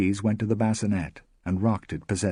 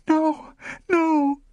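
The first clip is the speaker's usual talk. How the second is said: high, loud cry of horror; a high, loud plea; horrified; 6 dB louder than usual. A narrator's reading. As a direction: recoil and cry out. Rosemary recoiling in horror.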